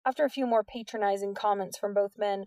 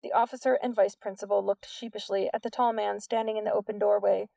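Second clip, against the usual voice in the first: plain reading voice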